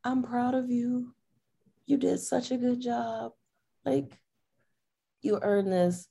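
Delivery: high, slow, cooing praise; tender reassurance. Retold or imitated. Girlfriend to crying partner after his win, tender pride. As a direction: praise slowly and gently, up high.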